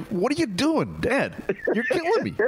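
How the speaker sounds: very high-pitched mock protest; imitated exasperated son. Son protesting his dad's bad joke, high mock exasperation. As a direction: squeal it like a mortified son.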